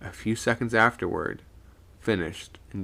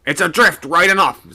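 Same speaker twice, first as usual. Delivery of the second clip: skipper voice; 8 semitones higher, 11 dB louder than usual. A narrator's reading. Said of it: "said the skipper".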